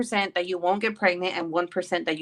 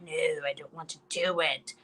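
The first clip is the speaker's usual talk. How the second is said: reluctant whining; 4 semitones lower, 8 dB quieter than usual. Retold or imitated. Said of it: "I hate this fucking class with a passion".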